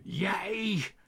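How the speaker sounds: hoge, luide uitval; trillend van woede. A narrator's reading. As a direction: spuw het woord uit van woede. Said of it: "trillend van woede". (meneer Pardoes valt boos uit tegen Tos)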